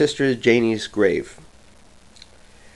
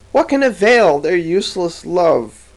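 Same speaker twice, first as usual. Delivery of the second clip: usual speaking voice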